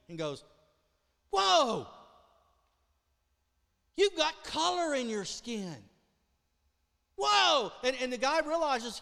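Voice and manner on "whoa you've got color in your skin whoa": loud, high astonished cry of whoa; amazed shout. Acted. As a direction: shout it out in wonder at a miracle. Leper sees friend's skin healed.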